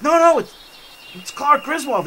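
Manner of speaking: high, urgent retold explanation; hurried explaining. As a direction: wave off their alarm, pitched high. Reassuring alarmed friends after yell.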